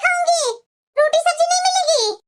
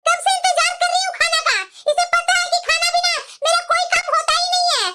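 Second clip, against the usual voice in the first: put-on higher, nagging old-woman voice; scolding mother-in-law; 4 semitones higher than usual. Mother-in-law complaining to her daughters-in-law about late food, nagging. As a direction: grumble like a bossy saas.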